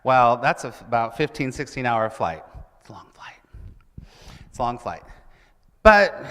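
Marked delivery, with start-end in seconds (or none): 2.8-3.4 a weary whispered aside. Acted. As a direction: breathe it out as a tired aside. Whispered repeat of a line.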